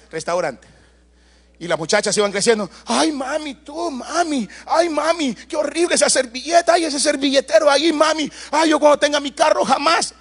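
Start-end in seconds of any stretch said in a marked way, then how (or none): 2.8-10.1 put-on whiny teen daughters. Teen daughters mocking mother's habit.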